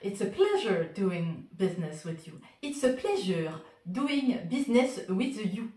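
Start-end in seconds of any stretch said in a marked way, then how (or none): 2.6-5.7 slow, heavily French-accented English. Shown as a demonstration. Repeating the shop phrase for viewers, French accent. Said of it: "avec l'accent français".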